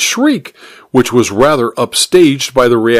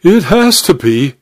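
high, insistent duchess; acted, pressing certainty; 6 semitones higher than usual. A narrator's reading. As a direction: insist on it in a higher voice. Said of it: "she insisted".

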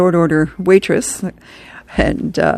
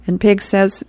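ordinary talk, nothing marked

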